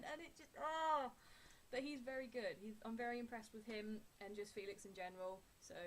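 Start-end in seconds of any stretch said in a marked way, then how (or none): 0.1-1.1 overwhelmed sigh. Real, not acted.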